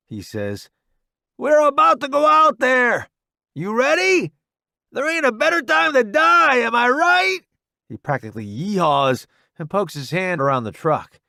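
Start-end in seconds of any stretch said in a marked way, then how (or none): 1.4-7.4 high loud gung-ho shout; reckless excitement. Acted. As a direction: whoop it out. Reckless soldier before charging.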